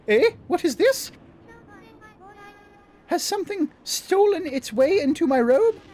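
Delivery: surprised goddess. Acted. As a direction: react as if something's in your robe. Voicing Sakuya surprised.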